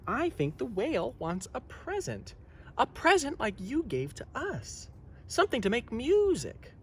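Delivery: put-on high, soft little boy; a small son shyly suggesting; child voice. Little Nut, Noggin's son.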